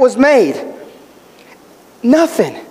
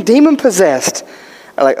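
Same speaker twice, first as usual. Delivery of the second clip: ordinary talk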